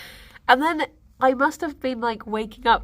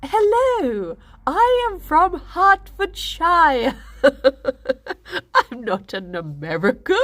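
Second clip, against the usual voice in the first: exaggerated British accent; 6 semitones higher, 4 dB louder, slower than usual. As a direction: lay on the most British voice possible. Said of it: "trying to do the most British voice".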